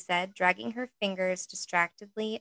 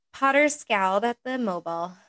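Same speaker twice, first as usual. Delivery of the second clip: ordinary delivery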